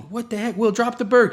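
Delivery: put-on high, loud panicked yell at a kid; alarmed shout. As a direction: yell at him to drop it now. Relatives shouting at child with bird.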